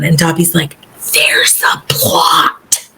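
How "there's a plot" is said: slow, hushed dramatic warning; Dobby. Retold or imitated. House-elf revealing a plot to Harry, ominous slow warning. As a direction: draw out the ominous secret, half whispered.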